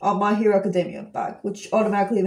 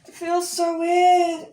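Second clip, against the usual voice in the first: high, slow drawn-out whine; mock wail; 9 semitones higher, 4 dB louder, slower than usual. Complaining about being twenty-five or twenty-six, mock wail. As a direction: stretch the complaint out, pitched high.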